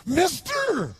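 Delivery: put-on high, strange cracked call of a drunk; bizarre drunk voice. Drunk man stopping a stranger on the street, bizarre high call. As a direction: hail a stranger in a weird high voice. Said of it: "He had this really bizarre looking sounding voice".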